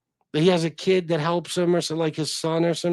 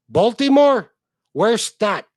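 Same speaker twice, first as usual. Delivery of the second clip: mock Baltimore accent; 7 semitones higher, 9 dB louder, slower than usual. Mocking Baltimore pronunciation.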